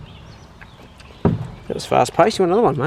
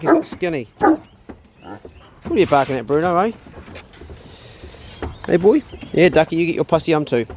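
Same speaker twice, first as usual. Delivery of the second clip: usual speaking voice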